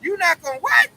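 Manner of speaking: high, loud, mock-indignant; offended disbelief. Esau reacts offended when Jake refuses to be used, high and mock-shocked. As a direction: act shocked that he's refused.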